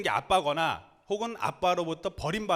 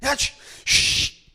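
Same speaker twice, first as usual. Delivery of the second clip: loud, drawn-out hushing; scolding shush; 8 semitones higher, 8 dB louder, much slower than usual. Acted. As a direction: shush the beggar hard. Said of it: "사람들이 뭐라고 했을까요".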